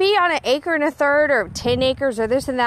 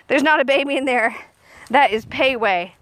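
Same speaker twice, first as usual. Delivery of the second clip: ordinary delivery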